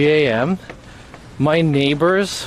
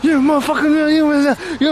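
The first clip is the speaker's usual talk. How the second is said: high, louder imitation of a cursing man; mock angry yell; 13 semitones higher, 3 dB louder than usual. Retelling a neighbor cursing in a fight, mock angry yell. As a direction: act out the guy cussing someone out. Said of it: "this guy, like, hella".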